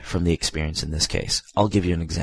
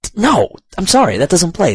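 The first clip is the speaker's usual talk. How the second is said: high-pitched, loud incredulous objection; exasperated protest; 11 semitones higher, 5 dB louder than usual. Real, not acted. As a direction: object loudly, voice jumping way up. Protesting the jarring dialogue.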